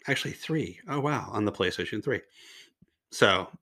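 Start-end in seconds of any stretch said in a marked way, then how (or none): none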